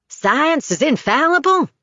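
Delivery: high, scornful question; mocking question. A narrator's reading. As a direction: sneer at the idea. Father mocks science.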